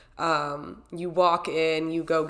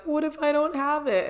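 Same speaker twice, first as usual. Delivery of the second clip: panicked worry; 10 semitones higher than usual. Retold or imitated. Freaking out about her bib.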